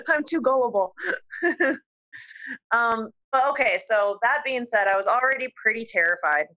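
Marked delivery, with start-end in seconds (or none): 0.0-1.8 laughing through the words, a little higher. Real, not acted. Guest laughs at herself for believing the film, laughing. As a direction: giggle it out at your own gullibility. Laughing about being fooled by movie.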